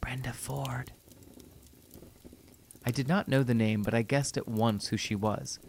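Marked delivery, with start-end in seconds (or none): 0.0-0.9 a toneless, breathy whisper from the dark; eerie whisper. A narrator's reading. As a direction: barely breathe the name, unvoiced. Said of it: "the whisper came".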